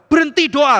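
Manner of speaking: loud, high stern order; stern command. Acted. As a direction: command them to stop. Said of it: "kata Tuhan". (Tuhan memerintah dengan keras dan tegas)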